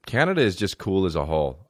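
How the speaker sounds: usual speaking voice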